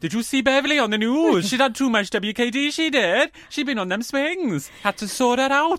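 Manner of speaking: high, loud Welsh gossip; gossiping Welsh local. Acted. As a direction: spread scandal in a hammed-up Welsh accent.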